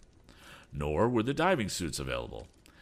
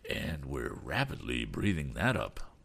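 lower-pitched grave remark; mildly grave, low voice; solemn; 5 semitones lower than usual. A narrator's reading. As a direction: add it soberly, pitched down. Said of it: "added Captain Weston solemnly".

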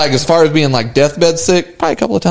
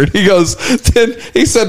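ordinary talk, nothing marked

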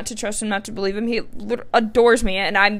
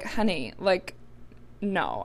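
gentle reassurance; 2 semitones lower, 11 dB quieter, slower than usual. Retold or imitated. Boyfriend gently dismissing her worry.

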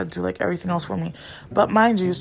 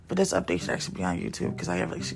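low unvoiced whisper; whispered aside; 7 dB quieter than usual. Real, not acted. Whispered aside about posting on YouTube. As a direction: whisper it as a side note.

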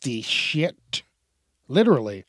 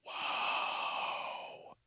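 whispered whoa; 15 dB quieter than usual. Retold or imitated. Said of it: "now you're just like".

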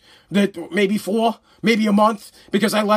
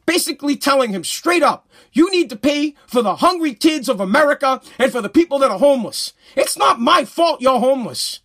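angry rant; 3 semitones higher than usual. Real, not acted. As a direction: vent it hard, really fired up. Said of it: "That's me just ranting".

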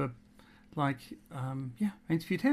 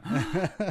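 excited gasp; 5 semitones higher than usual. Real, not acted. Shocked at the email.

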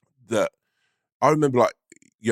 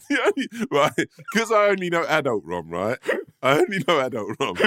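laughing through words; 8 semitones higher than usual. Real, not acted.

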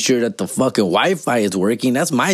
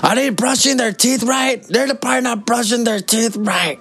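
mocking his nagging ex; 13 semitones higher than usual. Mocking the mom fretting over the kids' teeth, high mock whine. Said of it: "one of the kids' breath stinks".

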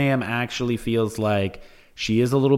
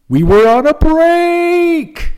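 shouted catchphrase; 14 semitones higher, 9 dB louder, much slower than usual. Retold or imitated. Ross catchphrase quoted.

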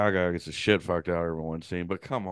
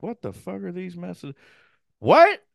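shocked disbelief; 10 semitones higher, 5 dB louder than usual. Retold or imitated. Retold shocked reaction.

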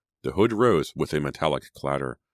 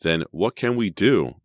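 louder, higher-pitched demand; 5 semitones higher, 6 dB louder than usual. A narrator's reading. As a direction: demand an answer with rising urgency. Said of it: "Sydney demanded".

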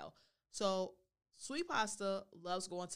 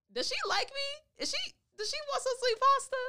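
very high, loud self-questioning; excited wondering; 10 dB louder than usual. Acted. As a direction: squeal the question, hammed up. Acting excited wondering to herself.

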